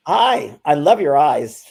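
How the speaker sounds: sweetly compliment a stranger's eyes; cheerful greeting. Retold or imitated. Retold greeting to stranger in elevator.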